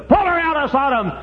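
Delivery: high, loud preaching outburst; sudden shout. Real, not acted. Preacher on the wife dragged from Sodom, sudden shout. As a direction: burst into a shout.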